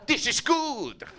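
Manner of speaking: high, slow, dazed dancer voice; mock a blissed-out guy on the dance floor; imitating drunk dancer. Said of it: "just like".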